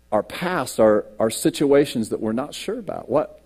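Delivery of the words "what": ordinary talk, nothing marked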